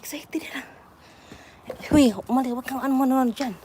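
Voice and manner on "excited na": whispered aside. Real, not acted. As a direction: whisper it softly.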